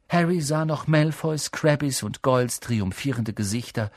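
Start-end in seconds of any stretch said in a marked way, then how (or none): none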